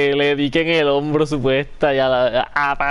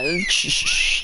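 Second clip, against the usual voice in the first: hushing shushes; chistido para calmar. Real, not acted. Hushing the crying baby. (chistidos para callar al bebé)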